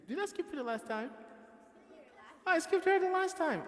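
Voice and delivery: "I skipped her the last time" rising to a high, loud dismayed cry; surprised. Real, not acted. Teacher realizes she skipped a student, high shocked cry.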